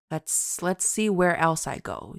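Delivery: ordinary talk, nothing marked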